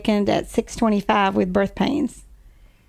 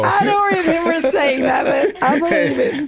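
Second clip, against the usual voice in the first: laughing; 5 semitones higher than usual. Real, not acted. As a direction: laugh it out while admitting it. Wife laughing at the retold quote.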